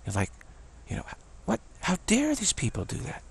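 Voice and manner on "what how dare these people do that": retold indignant protest, voice lifted high; imitated fury. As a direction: act out a friend's indignation without shouting. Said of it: "She was furious, furious with this".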